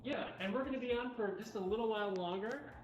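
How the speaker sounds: usual speaking voice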